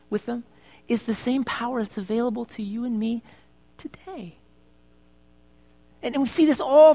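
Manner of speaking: quiet heartfelt preaching with music underneath; soft intimate preaching. Acted. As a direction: draw them in gently.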